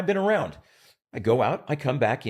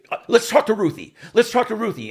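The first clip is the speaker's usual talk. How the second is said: cheerful dodge; 10 semitones higher, 10 dB louder than usual. Retold or imitated. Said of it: "He said".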